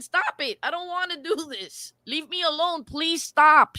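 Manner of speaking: high-pitched imitation of pleading; begging. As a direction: mimic someone begging to be left alone. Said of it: "She's begging".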